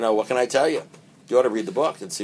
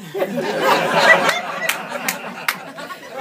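breathy unvoiced burst of laughter; airy laugh; 6 dB louder than usual. Real, not acted. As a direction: let out a soundless laugh. Laughs at his own story.

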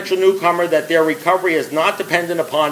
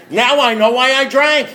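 much higher, slow, drawn-out realisation; acted revelation; 8 semitones higher, 4 dB louder than usual. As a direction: draw it out high, like a mock epiphany. Acted realisation at eighteen months sober.

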